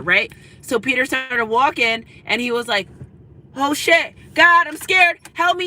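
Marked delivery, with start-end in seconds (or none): 3.6-5.6 higher, louder, panicky plea; mock scared. Peter crying out for help while sinking in the water. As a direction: cry out as if sinking.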